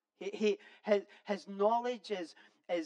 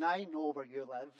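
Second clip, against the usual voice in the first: put-on amused, lower Irish accent; 5 semitones lower than usual. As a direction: say it like your Irish mates would. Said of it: "I can't help read it with an Irish accent".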